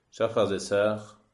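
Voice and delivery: ordinary talk, nothing marked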